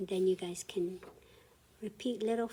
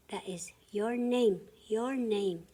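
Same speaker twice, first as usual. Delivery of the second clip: normal talking voice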